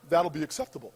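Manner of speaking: very low, soft, suave reply; suddenly composed. Acted. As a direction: drop way down, quiet and dignified. Cool reply to first-class offer.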